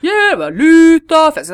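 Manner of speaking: loud, high put-on northern voice; mock dialect shout. Stranger repeats the mocking line, loud high shout. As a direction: yell it like a rude stranger mocking. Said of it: "Hva behager?".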